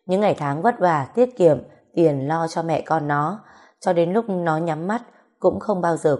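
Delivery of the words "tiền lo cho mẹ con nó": plain reading voice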